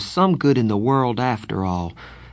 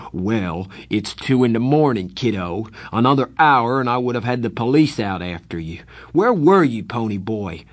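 growing louder, angrier brother; rising anger; 2 semitones higher, 4 dB louder than usual. Acted. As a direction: build from stern to shouting. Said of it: "His voice was rising".

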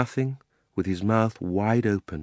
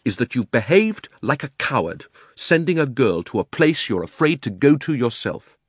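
put-on mildly heated boy, raised voice; angry; 6 semitones higher, 3 dB louder than usual. A narrator's reading. Diggory calls his uncle a coward, angry. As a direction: accuse him with a touch of indignation. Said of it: "flaming with anger now".

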